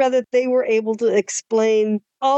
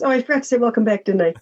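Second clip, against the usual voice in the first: ordinary delivery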